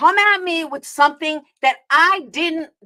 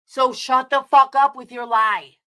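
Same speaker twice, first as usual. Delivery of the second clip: ordinary delivery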